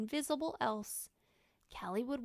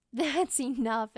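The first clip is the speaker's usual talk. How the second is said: loud, slow laughing call; 9 dB louder, slower than usual. Acted. Mother to children filling the box, laughing. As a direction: laugh as you stop them. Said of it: "Ellen laughed".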